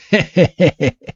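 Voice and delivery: high staccato mock laugh; acted dismissive giggle; smug rival chuckle. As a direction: snicker at your opponent. Rival laughs smugly while explaining.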